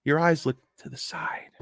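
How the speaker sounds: hushed unvoiced aside; whisper. Real, not acted. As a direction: breathe it out as a secret.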